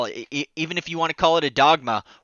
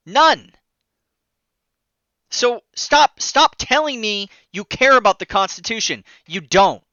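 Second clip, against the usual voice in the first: shrill anger; 4 semitones higher, 3 dB louder than usual. Real, not acted. Ranting at opponents on camera.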